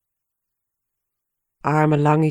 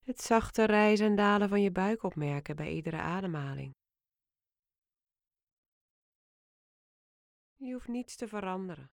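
fading to a murmur; 12 dB quieter than usual. Acted. Meditation voice fading out.

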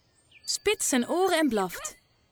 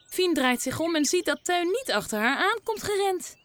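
plain reading voice

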